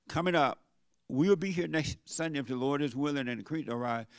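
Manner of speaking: ordinary talk, nothing marked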